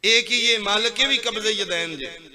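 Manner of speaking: high, loud plea to the Lord; loud pleading. Acted. Worshipper pleads loudly to Allah about hand position. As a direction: cry it out as if before the throne.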